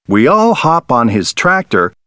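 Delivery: ordinary delivery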